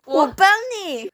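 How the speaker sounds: playful character line. Acted. Co-host voices character with lipstick.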